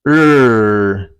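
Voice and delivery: long, loud held 'er' with curled tongue; rolled-tongue R. Shown as a demonstration. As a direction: hold the R sound in 'er', loudly. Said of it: "it's pronounced".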